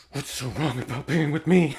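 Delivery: mock-earnest plea; imitated movie character. Retelling the film character's line, mock-earnest plea. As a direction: put on his pleading voice.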